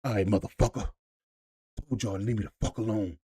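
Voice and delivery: gruff tough-guy line, fast at the end; a hint of an aggressive action hero; lightly voiced badass vampire hunter; Blade impression.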